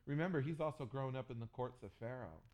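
usual speaking voice

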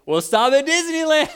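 loud, high, thrilled announcement; excited dad. Retold or imitated. Dad bribing his sons with Disneyland, loud and high. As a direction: sell the treat big.

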